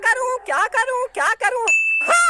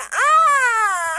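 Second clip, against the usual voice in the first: pained witch groan; 2 semitones higher, 4 dB louder than usual. Acted. Witch's headache.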